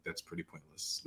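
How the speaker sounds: muttered under the breath; deadpan aside, barely voiced; quiet deadpan quote. To herself about not applying to NYU, muttered. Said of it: "I'm like".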